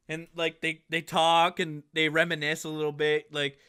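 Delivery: usual speaking voice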